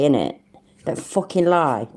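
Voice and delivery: normal talking voice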